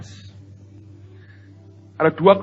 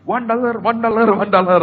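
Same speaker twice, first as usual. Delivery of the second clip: imitated souvenir seller, higher and insistent; raised, repetitive sales chant; hawker's call; 7 semitones higher, 6 dB louder than usual. Vendor pestering a tourist with souvenirs, hawker's call. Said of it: "itu kan ditawari".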